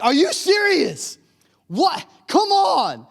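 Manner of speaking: loud, high-pitched mock indignation; exasperated outburst. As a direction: cry out in hammed-up disbelief. Acted outburst at blaring rap song.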